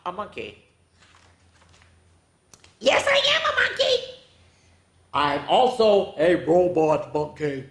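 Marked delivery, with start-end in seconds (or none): 2.8-4.0 very high fast squeaky monkey; silly high squeak. Acted. Book forces monkey line. 5.1-7.6 low mechanical robot voice; lightly voiced robot. Reads robot monkey line.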